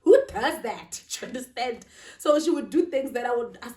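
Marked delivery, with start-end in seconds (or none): 0.0-1.0 higher, mock incredulous rant; mother retelling her disbelief at a stepdaughter's ways; exasperated disbelief. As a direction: retell your shocked reaction, a little hammed-up. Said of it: "I was like".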